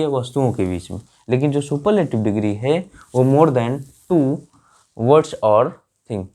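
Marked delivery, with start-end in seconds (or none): 3.2-6.3 slow emphasis. Shown as a demonstration. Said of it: "stresses rule for students".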